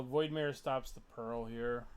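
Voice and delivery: ordinary delivery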